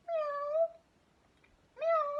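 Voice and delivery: very high-pitched mock meows; cat meow imitation. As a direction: mew like a cat, high and thin. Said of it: "Next sound".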